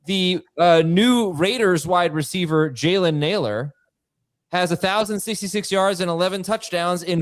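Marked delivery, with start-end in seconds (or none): none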